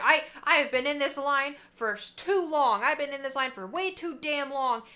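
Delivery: mock Karen yelling about the wait; angry Karen rant. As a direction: rant at the worker like an entitled customer. Angry Karen in drive-thru line.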